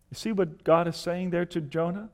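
usual speaking voice

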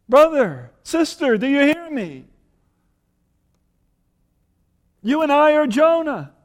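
loud, high call to the listeners; passionate appeal. Real, not acted. Preacher calling out to the congregation, loud and urgent. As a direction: plead with the congregation.

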